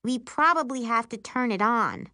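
high-pitched know-it-all sister. A narrator's reading. Sister corrects narrator about the TV, know-it-all voice. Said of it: "the know-it-all says".

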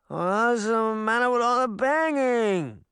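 high, slow sleepy grumble; complain, half awake; grumbling. A narrator's reading. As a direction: woken little girl grumbling at the noise. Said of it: "she grumbled".

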